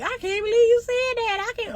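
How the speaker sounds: lightly imitating a scandalized woman; mock shocked woman.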